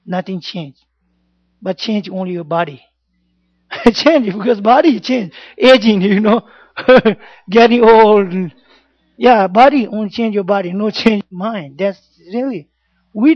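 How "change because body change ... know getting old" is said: laughing. Real, not acted. Teacher laughing about aging body.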